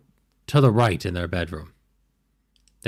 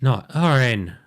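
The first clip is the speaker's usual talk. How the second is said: higher, frantic gnome telling of the killings; panicked gnome; 7 semitones higher, 3 dB louder than usual. Acted. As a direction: babble it out like a terrified little gnome. DM voices terrified gnome.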